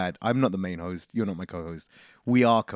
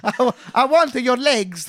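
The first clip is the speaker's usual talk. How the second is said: put-on much higher, louder Italian accent, laughing; mock Italian accent; 12 semitones higher, 4 dB louder than usual. Mocking his friend's failed French voice, hammed-up Italian accent. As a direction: ham up a cartoon Italian, breaking into laughs. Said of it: "That was Italian is what that was".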